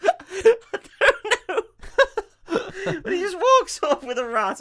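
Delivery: high, loud words through giggles; helpless laughter. Real, not acted. Teller baffled by the plot he retells, helpless laughter. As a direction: struggle to speak for laughing.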